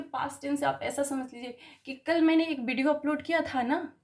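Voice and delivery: ordinary delivery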